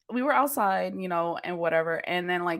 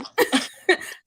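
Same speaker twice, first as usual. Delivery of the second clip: laughing; 10 semitones higher than usual. Real, not acted. Laughing about being high.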